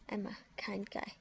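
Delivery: breathy creepy whisper to Emma. Pretend. Whispering a creepy troll line to friend Emma. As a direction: hush it like a secret threat.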